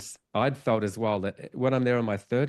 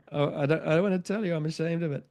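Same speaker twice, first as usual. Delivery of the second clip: higher, pleading little voice; a lightly hammed-up embarrassed confession; mock ashamed; 7 semitones higher than usual. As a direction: play up the shame for a laugh. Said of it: "like, uh".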